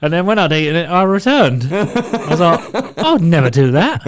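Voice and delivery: laughing through the words. Real, not acted. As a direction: giggle as you deny it. Laughing while telling the story.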